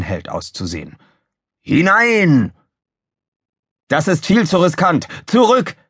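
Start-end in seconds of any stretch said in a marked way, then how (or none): 1.6-2.5 high, loud drawn-out command; rallying shout. A narrator's reading. Would-be hero orders ship in, rallying shout. Officer urges flying in. 3.9-5.8 high call, rising to a shout; Gizmo calling out. A narrator's reading. Engineer orders a retreat, urgent call. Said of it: "rief Gizmo".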